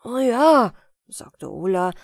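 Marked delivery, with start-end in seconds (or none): 0.0-0.7 put-on a loud, eager boy's yes; mock-eager child; eager agreement. Boy Ola voiced in tale.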